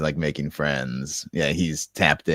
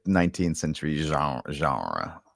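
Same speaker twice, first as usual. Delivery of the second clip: Trebek-style genre; slower than usual. Retold or imitated. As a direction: say genre like Trebek, French zh, falling. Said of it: "does the Alex Trebek".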